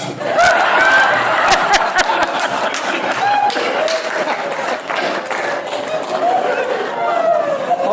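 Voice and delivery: the crowd laughs and keeps laughing; sustained roar of laughter; long audience laughter. Real, not acted.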